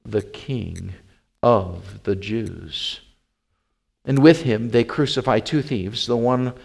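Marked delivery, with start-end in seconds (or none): 0.1-3.0 slow, low, soft reading; solemn inscription. A narrator's reading. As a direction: space out each phrase gravely. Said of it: "his accusation was written over".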